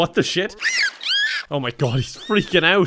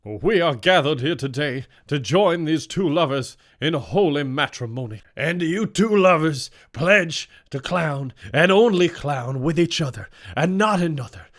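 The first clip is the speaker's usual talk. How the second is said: mock solemn priest. Officiant marrying the clown lovers, mock solemn tone. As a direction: intone it like a ceremony.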